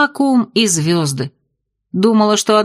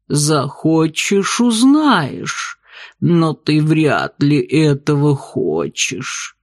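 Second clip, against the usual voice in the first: very slow, low alien voice; slow and otherworldly, calmly; gurgling tentacle alien; 5 semitones lower, much slower than usual. Acted. Octopus bartender speaks.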